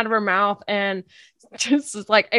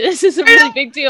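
insistent scolding; 7 semitones higher, 5 dB louder, faster than usual. Real, not acted. Mock-scolding laughing co-host.

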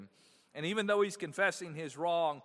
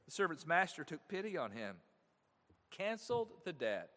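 ordinary talk

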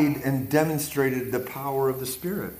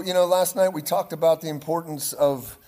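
normal talking voice

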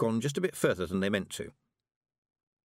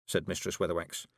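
plain reading voice

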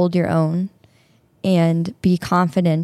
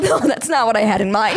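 ordinary delivery

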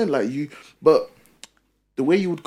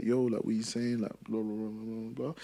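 quiet chat; 2 semitones lower, 10 dB quieter than usual. Shown as a demonstration. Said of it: "It's a little bit quiet".

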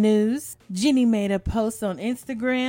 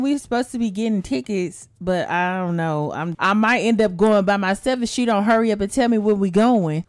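usual speaking voice

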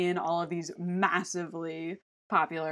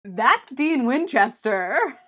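loud, drawn-out excited announcement; big slow excited shout; gleeful reveal; 6 semitones higher, 10 dB louder, much slower than usual. Real, not acted. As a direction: announce it with delight, stretched out and higher. Gleeful answer to a leading question.

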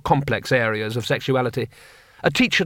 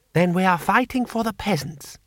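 higher-pitched, insistent character voice; lightly voiced idealist in higher pitch; earnest young rebel; 6 semitones higher than usual. A narrator's reading. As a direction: argue back with earnest conviction. Butterfly argues back, character voice.

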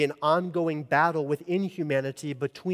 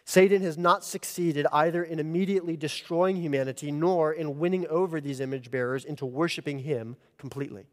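usual speaking voice

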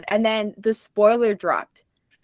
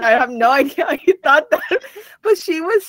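higher voice breaking into laughter; laughing; 6 semitones higher, 3 dB louder than usual. Real, not acted. Laughing while retelling her friend's mix-up to co-hosts. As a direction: laugh through the words.

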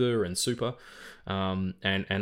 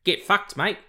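raised, louder Australian brush-off; mock Aussie dismissal, pitched up; Australian accent; 9 semitones higher, 5 dB louder than usual. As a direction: put on a punchy Australian accent. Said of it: "Why am I doing an Australian accent?".

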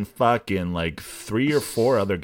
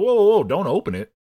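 fast, high, loud warning; mock alarm; 8 semitones higher, 6 dB louder, faster than usual. Host retelling the cops being warned off the package, mock alarm. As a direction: blurt an urgent stop, hammed-up. Acting out urgent warning about bomb.

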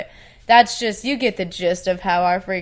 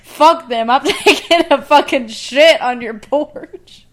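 loud laughing mock rant; 5 semitones higher, 8 dB louder, slower than usual. Acting out revenge, loud laugh.

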